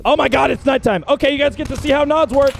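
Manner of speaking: ordinary talk, nothing marked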